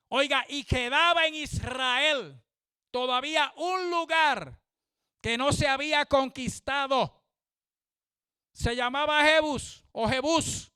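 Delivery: high, loud, slow preacher's cry; fervent pulpit preaching; full-force preaching. Acted. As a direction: proclaim it to the whole congregation.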